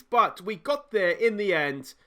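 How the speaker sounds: usual speaking voice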